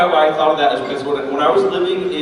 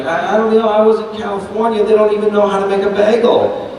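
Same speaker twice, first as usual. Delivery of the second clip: put-on higher, quick-griping New York accent; New York mom; 6 semitones higher, faster than usual. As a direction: imitate a complaining New York mother. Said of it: "his mom is talking to somebody".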